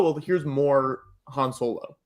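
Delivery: ordinary talk, nothing marked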